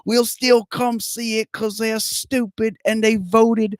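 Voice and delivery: high, very slow, overdone Aussie voice; hammed-up Australian rant on the sequel; awful Australian accent. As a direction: keep the bad Australian going while ranting. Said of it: "Your accent is awful".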